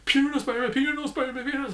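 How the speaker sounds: high, loud mock blabbing voice; mocking the snitch friend. Vlogger mocks the snitch friend outing Peter, high loud blabbing. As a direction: blurt it out like a gleeful tattletale, high and loud. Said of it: "He immediately goes".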